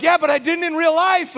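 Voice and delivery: high, loud mock protest; incredulous wife. Wife laughing at husband's dream grievance, high loud imitated protest.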